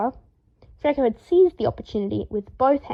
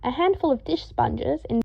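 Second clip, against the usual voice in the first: ordinary delivery, nothing marked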